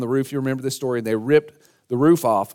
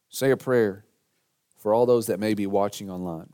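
low-key, softer prayerful voice; quiet, reverent pastor's prayer; hushed prayer; 3 semitones lower, 5 dB quieter than usual. Real, not acted. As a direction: lead the room gently in prayer, with long pauses. Leads congregation in prayer.

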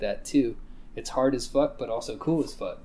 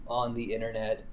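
usual speaking voice

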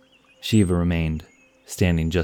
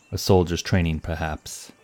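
ordinary delivery, nothing marked

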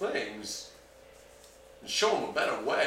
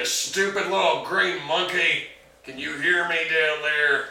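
calling down from tower; 5 semitones higher, 7 dB louder than usual. Acted. Shouting down to Dobby.

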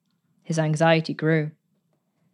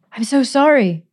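higher, rushed and breathless; a girl gasping her apology, higher and hurried; 7 semitones higher, 4 dB louder than usual. A narrator's reading. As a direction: gasp it out, relieved to find him. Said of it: "gasped Mary".

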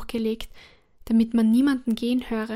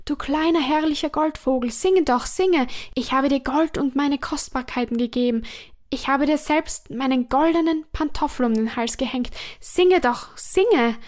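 desperate pleading; 3 semitones higher, 4 dB louder than usual. Acted. Dying emperor begs the golden bird to sing, loud and pleading.

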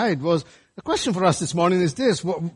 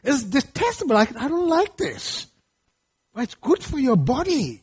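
fussy eater; 9 semitones higher than usual. Pretend. Preacher mocking a child who hates greens, whiny high voice. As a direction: pull a face like a kid refusing greens.

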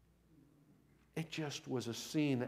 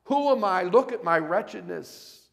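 loud, high cry of unworthiness; anguished outcry; 6 semitones higher, 11 dB louder than usual. Acted. Isaiah in the presence of God, loud anguished outcry. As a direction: exclaim it as one overwhelmed before God.

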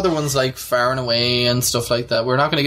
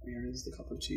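quiet mock quote; 21 dB quieter than usual. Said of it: "quoting her sentence".

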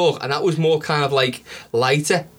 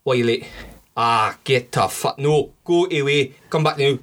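mock Scottish accent. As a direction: stretch out a badly faked Scottish accent. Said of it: "He sounds fucking Indian".

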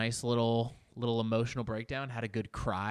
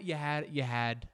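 ordinary delivery

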